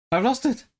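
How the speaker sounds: high-pitched cry of dismay. Real, not acted. Streamer losing the trill during practice, dismayed. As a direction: blurt it out high, in disbelief.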